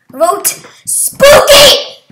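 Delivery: top-of-the-lungs yell, high and drawn out; very loud, high campaign shout. Real, not acted. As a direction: yell it with all your lungs. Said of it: "I'm yelling at the top of my lungs".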